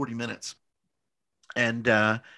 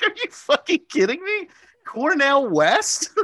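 stunned disbelief; 14 semitones higher, 6 dB louder than usual. Retold or imitated. Stunned that Cornell West vouched.

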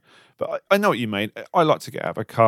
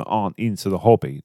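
ordinary talk, nothing marked

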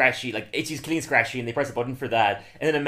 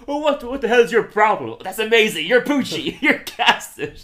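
higher, louder, hammed-up exasperation; mock outburst; 8 semitones higher, 7 dB louder than usual. Retelling the casting gag, loud hammed-up exclamations. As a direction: play the flip-flop big. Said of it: "He's like".

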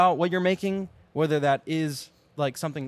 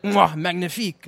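french chef; 4 semitones higher, 6 dB louder than usual. Retold or imitated. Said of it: "you're like".

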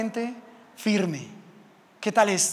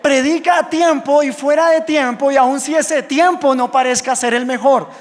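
louder and higher, pounding each word of scripture; fervent preaching shout; 5 semitones higher, 7 dB louder than usual. Acted. Pastor thunders scripture at the congregation, loud and high. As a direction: pastor proclaims the verse to his congregation with force. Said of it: "Cuando dice Timoteo".

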